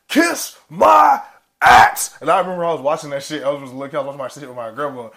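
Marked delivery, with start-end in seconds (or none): 0.1-2.2 high, loud battle shout; triumphant yell of a cartoon hero; shouted catchphrase. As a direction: bellow it like a cartoon hero swinging an axe. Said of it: "And he's like".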